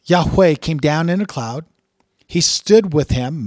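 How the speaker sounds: solemn scripture reading. Acted. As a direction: read it out like a proclamation from the pulpit. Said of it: "So let me just read it for you".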